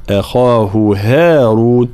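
slow chant of "أخاه", thin a then full kha; light hamza, heavy kha. Shown as a demonstration. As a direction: recite "أخاه هارون" with long vowels, heavy kha. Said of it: "لابد من ترقيق الهمزة، تفخيم الخاء". (ترقيق همزة أخاه وتفخيم الخاء)

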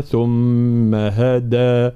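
ثم هدى recited very slowly with a clear voiced د; drawn-out tajweed ثم هدى; firm voiced dal. Shown as a demonstration. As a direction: hold the hum in ثم and strike a solid d in هدى. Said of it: "الدال حرف شديد ومجهور".

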